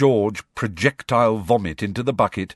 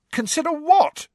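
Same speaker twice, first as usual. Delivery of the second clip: angry; 3 dB louder than usual. A narrator's reading. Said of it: "said Ron angrily".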